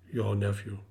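low, quiet spirit; ghost voice. A narrator's reading. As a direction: say it low and solemn. The ghost speaks.

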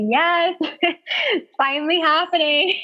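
high, emotional retold reply; retold excitement. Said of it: "she was so excited".

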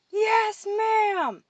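high, shouted cadet reply; boisterous response. A narrator's reading. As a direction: answer loudly as a whole crowd. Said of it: "The boisterous response rang out".

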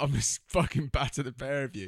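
retold threat, played big; mock threat. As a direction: bark out a hammed-up threat. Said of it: "And he's like".

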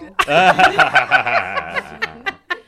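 loud, high burst of laughter; laughing. Real, not acted. Host hears a friend's joke, long loud guffaw. Reacts to co-host's off-mic joke.

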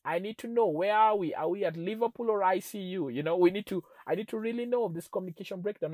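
loud, high, insistent demand; retold exasperated plea. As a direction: press for an answer, acting out frustration. Acting his plea to his wife.

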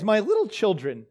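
ordinary talk, nothing marked

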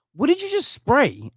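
high-pitched incredulous challenge; startled, indignant, without shouting; retold indignant question. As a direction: confront them in disbelief, pitch jumping up. Retold confrontation with a passenger.

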